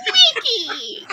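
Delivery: high shouted squawk, laughing; mock Donald Duck while laughing. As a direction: yell it like the cartoon duck through laughter. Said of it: "that's not it".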